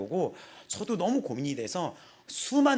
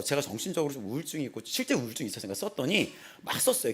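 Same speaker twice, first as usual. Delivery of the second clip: ordinary talk, nothing marked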